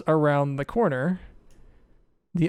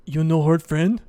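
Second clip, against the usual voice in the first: questioning simple monster; 2 semitones higher, 5 dB louder than usual. Acted. Verbeeg asks Rogar for a promise, simple questioning voice. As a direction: ask it in simple broken speech, rising at the end. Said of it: "tilts his head and he says".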